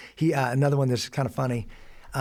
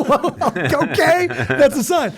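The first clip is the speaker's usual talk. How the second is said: laughing; 13 semitones higher, 8 dB louder, much slower than usual. Real, not acted. Laughing at son's silly sign.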